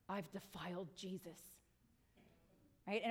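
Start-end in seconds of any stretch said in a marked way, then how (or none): 0.1-1.4 hushed imitated dread; mock-horrified confession; mock horror. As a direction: say it like you've done something terrible, softly. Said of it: "where you're like".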